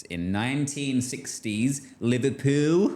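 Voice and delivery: hammed-up Scouse on the place name; terrible Liverpool accent. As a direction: attempt a Liverpool accent, badly. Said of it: "that was my terrible accent".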